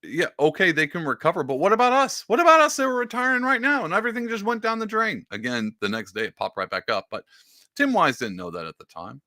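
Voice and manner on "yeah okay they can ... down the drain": put-on high, whining old complainer; high pitched mock boomer whine; whiny boomer impression. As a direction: wail it like a panicked retiree. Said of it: "He's like".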